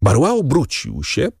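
plain reading voice

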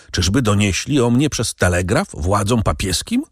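plain reading voice

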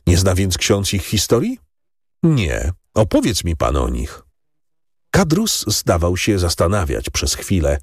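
ordinary delivery, nothing marked

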